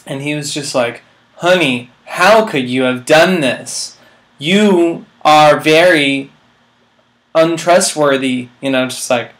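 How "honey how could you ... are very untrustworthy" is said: slow, loud, wooden line reading; terrible acting. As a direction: read it stiff like a bad actor. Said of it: "completely, like, terrible".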